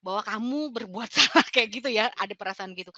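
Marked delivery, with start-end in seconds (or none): none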